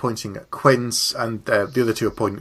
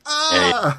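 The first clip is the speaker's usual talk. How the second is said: mock yelp of pain; 13 semitones higher than usual. Shot in the leg, retold.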